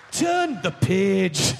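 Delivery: very slow, drawn-out storybook cue; hammed-up, lingering read-along prompt; storybook page-turn cue. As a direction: stretch it out like a read-along recording.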